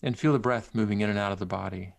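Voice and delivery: unhurried and gentle, leave long pauses; centering exercise. Acted. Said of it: "lead you through a, a brief centering exercise".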